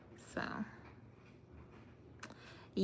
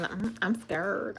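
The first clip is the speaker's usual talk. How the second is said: ordinary talk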